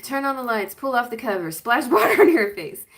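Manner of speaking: mock drill-sergeant wake-up; harsh wake-up orders. Other parents rousing a sleeper roughly, loud mock commands. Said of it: "other people will be like".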